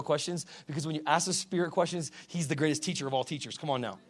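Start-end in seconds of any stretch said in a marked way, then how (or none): none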